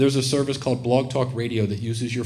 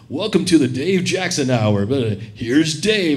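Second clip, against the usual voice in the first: loud, big announcer delivery; booming host intro; 7 dB louder than usual. Retold or imitated. Podcast host opening the show with a loud announcer voice. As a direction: boom it out like a radio announcer.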